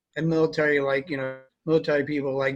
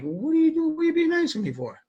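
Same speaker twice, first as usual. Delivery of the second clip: high-pitched incredulous questioning; mock suspicion; 12 semitones higher than usual. Soldier questioning a comrade's sudden kindness, in mock high-pitched suspicion. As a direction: squeal it like you distrust the kindness. Acted suspicion of someone being nice.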